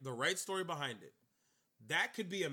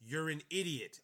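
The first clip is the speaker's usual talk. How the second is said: usual speaking voice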